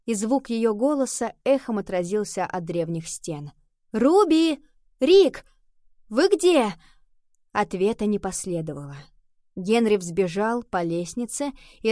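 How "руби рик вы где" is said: high, loud searching calls; calling out. A narrator's reading. Nova calls for her missing friends, shouting. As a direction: shout for someone who may be hidden.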